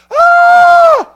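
loud, very high mock scream; imitated scream. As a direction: scream out like a startled neighbour. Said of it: "across the street was going".

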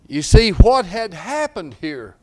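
loud, high, driving sermon voice; fiery pulpit preaching; preaching at full force. Acted. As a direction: preach it out to the whole church.

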